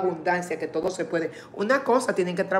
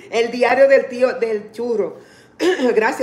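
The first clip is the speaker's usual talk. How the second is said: laughing while reading; 4 semitones higher, 7 dB louder than usual. Real, not acted. Streamer reading a funny channel name, laughing. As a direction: read the name through loud laughter.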